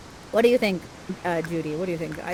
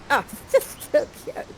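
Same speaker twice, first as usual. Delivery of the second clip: much higher squeal of delight; gushing squeal. Real, not acted. Narrator gushing over the love scene, much higher squeal. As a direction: squeal it, overcome with cuteness.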